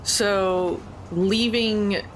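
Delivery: usual speaking voice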